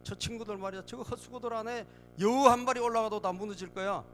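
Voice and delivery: acted scoffing, rising in pitch and volume; mocking jeer. Tobiah mocks the wall builders before his friends, scornful jeer. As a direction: jeer at their work, building to a loud high dismissal. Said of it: "이 사람은 한 수 더 떠".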